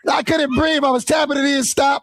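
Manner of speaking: high-pitched, shouted complaint; agitated protest. Real, not acted. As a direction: recount being choked, upset and high-pitched. Protests after being choked.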